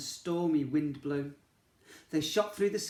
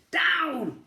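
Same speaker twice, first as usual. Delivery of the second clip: high, loud cry of Down; sharp urgent witch's cry; 12 semitones higher, 7 dB louder than usual. Acted. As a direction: shout it down to the ground. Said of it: "cried the witch".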